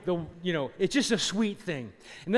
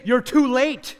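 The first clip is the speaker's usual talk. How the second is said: louder and higher, hammering it home; preacher thunders at the young man, loud and high; loud preaching retort; 7 semitones higher, 10 dB louder, slower than usual. Retold or imitated. Preacher's retort repeated loudly.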